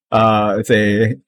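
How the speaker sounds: ordinary talk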